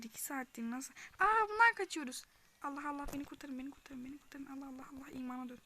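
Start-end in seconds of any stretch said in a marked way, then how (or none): 1.2-2.2 high loud startled yelp; sudden shriek of surprise; startled cry. Real, not acted. Sudden alarm in game. 2.6-5.6 frantic whispered pleading; breathless whispered begging; panicked whisper. Real, not acted. Panicked whispered pleas in game.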